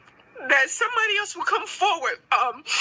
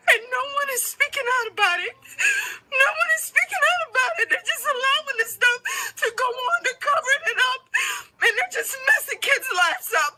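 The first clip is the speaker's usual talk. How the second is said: tearful outrage; 6 semitones higher, faster than usual. Real, not acted.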